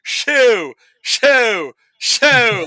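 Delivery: high, loud mock shoo; shooing a bat in his accent. Retelling Nick Cage shooing a bat out, high loud yell. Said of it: "he literally goes".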